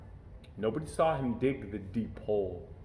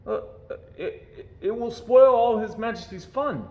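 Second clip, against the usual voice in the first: nervous stammer rising to a loud high protest; 9 semitones higher, 5 dB louder than usual. A narrator's reading. Eric the page boy stammering a protest to Lord Droon. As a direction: stumble over the words, then blurt it out. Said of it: "he stammered".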